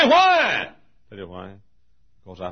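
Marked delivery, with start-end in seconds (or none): 0.1-0.7 high, loud drawn-out shout; demanding question. Acted. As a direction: shout the listener's question for them. Acts the listener asking why.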